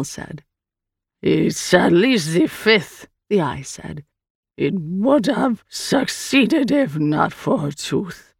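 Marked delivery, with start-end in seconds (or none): 1.2-3.1 very slow, drawn-out alien voice; strange creature. Acted. The Eye corrects Sal about Hand's attempts, slow creature voice. 4.6-8.3 very slow alien delivery; strange creature. Acted. The Eye recounts Hand's failed takeover, slow creature voice.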